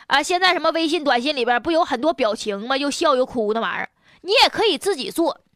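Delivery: normal talking voice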